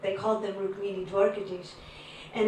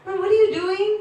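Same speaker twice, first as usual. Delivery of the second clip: high, loud, rising question; 10 semitones higher, 7 dB louder, faster than usual. Retold or imitated. Pretend phone chat.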